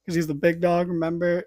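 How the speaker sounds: normal talking voice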